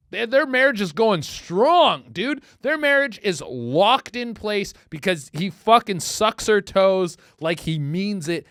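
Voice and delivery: loud, slow, high preaching; emphatic. Real, not acted. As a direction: preach it with mock conviction. Comic emphatic sermon on marriage.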